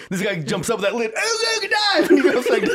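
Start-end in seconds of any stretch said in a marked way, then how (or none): 1.1-2.1 very high submarine klaxon cry; hammed-up, high-pitched yell through laughter; mock dive alarm. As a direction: imitate a recruit popping up with an alarm call. Recruit popping up shouting submarine alarm.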